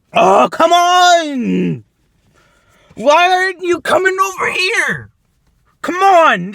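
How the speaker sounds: real exasperated yell; frustrated shout. Real, not acted. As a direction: yell at them to come over. Pleading shout at targets.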